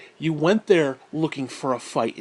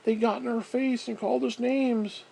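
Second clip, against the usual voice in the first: higher, whiny victim voice; mock whining; 8 semitones higher than usual. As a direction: whine like a pampered kid. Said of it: "And we're".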